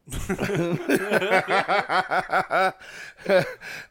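high, long burst of laughter. Real, not acted. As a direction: crack up in a rolling laugh. Laughing at the crying tease.

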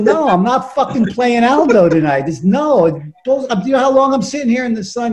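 high-pitched, worked-up retort; exasperated refusal. Retold or imitated. Actor tells buddy he won't play the prank, exasperated. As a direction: refuse heatedly, fed up with waiting.

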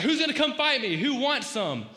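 loud, high jeering challenge; Goliath's taunt. Retold or imitated. Goliath taunting the Israelites again, loud jeer. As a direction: bellow it like a bully.

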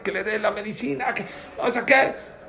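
mock yell, voice climbing through the demand; retold yelling. Desperate mother begging at the pharmacy, mock yelling. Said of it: "gritando ahí en la farmacia".

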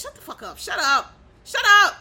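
building shout. Real, not acted. Ranting vlogger yells at critics, building to a shout.

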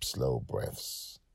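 plain reading voice

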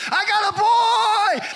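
high, loud, slow joyful yell; a new father yelling his news from a tabletop; joyful shout. Retold or imitated. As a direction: shout it out like a proud new dad. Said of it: "I started doing this".

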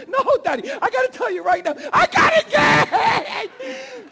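high, loud squeal building to a shriek; giddy daughter. Retold or imitated. Father imitating daughter announcing her engagement, giddy shriek. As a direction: mimic a girl shrieking her big news. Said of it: "she's all giddy".

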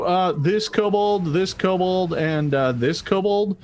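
ordinary talk

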